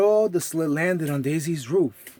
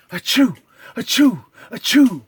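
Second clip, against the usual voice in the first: a chorus of big loud sneezes; 5 semitones higher, 7 dB louder than usual. Acted. Reindeer sneezing outside the window. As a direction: sneeze hard, high and loud. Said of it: "A chorus of sneezes".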